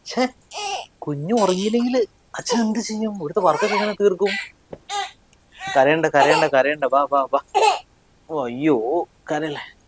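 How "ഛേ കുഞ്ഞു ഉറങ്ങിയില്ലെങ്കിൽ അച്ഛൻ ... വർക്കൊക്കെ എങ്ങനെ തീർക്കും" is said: whiny, higher, pretend-crying plea; father fake-whimpering to a baby who won't sleep; mock whimper. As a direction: fake a sob to win the baby over.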